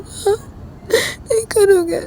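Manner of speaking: high, crying voice; tearful question. Acted. Ravi pleads with Rahul, crying. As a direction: ask it through tears. Hurt question about love.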